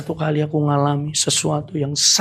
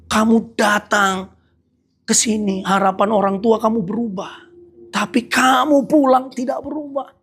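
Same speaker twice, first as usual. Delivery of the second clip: forceful preaching; 6 semitones higher than usual. Acted. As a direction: drive the rebuke home from the pulpit. Preaching builds to climax.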